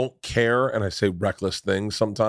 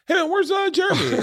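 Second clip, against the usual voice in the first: breezy question. Retold or imitated. Asking boss about missing guy.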